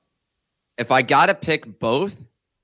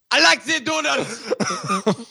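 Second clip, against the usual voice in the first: put-on slow, high villain German accent; 11 semitones higher, much slower than usual. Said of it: "can you say Doodles with a German accent?".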